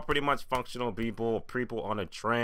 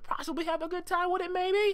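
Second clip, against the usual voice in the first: much higher, squeaky doubtful voice; mock falsetto. Reviewer doubting viewers will enjoy it, in a high mock squeak. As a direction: squeak it out, unconvinced.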